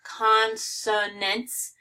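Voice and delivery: "Consonants" very slowly, in three separate syllables; overpronounced. Shown as a demonstration. Teacher showing the vowel in the middle, overpronounced. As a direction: stretch out each syllable of "Consonants". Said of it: "I'm overpronouncing it".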